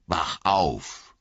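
mock echo with a wink; winking tease. A narrator's reading. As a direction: tease the elf lord back. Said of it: "sagte er Elrond zublinzelnd".

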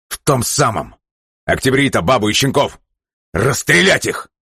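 cold rage giving orders; furious commander. A narrator's reading. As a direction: bark orders at a subordinate.

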